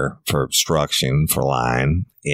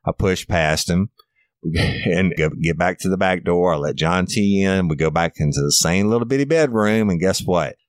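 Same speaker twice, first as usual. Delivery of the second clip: ordinary talk, nothing marked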